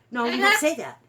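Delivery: a sharp, loud no to a cheeky pet; loud scolding. Real, not acted. Owner scolding her parrot for swearing, loud and firm. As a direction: shut the bad word down hard.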